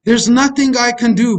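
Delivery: normal talking voice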